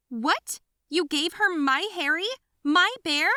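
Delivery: high, loud possessive outburst; big sister raging over her teddy bear; jealous fury. Acted. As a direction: scream that the bear is yours.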